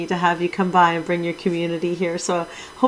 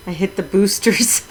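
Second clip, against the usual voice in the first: ordinary talk, nothing marked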